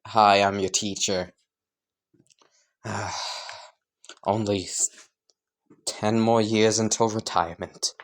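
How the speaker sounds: low, tired deadpan with a sigh; weary teacher. Acted. Aizawa greets class counting years to retirement, weary. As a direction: sigh and drag it out, low and worn out.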